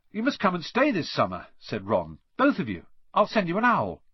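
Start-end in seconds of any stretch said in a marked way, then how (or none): 0.1-1.5 lightly voiced, quick, higher boy; eager Ron. A narrator's reading. Ron invites Harry for the summer, a touch higher. 2.4-4.0 lightly voiced, quick, higher boy; eager Ron. A narrator's reading. Ron invites Harry for the summer, a touch higher.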